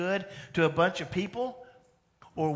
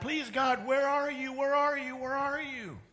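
pleading, pitched higher, repeated where are you; begging God; 8 semitones higher than usual. Retold or imitated. Preacher voicing Old Testament people begging God, higher pleading. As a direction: plead up to heaven like the desperate Israelites. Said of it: "they're just begging God".

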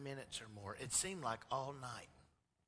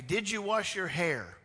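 slow, higher mom voice checking on son; mother quizzing her little boy; mom asking; 6 semitones higher, 10 dB louder than usual. Retold or imitated. Mom asking her son about his hair.

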